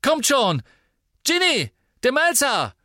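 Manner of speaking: high, loud joyful yell; exuberant shout. Acted. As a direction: call the party out with glee. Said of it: "schrie Dean ausgelassen".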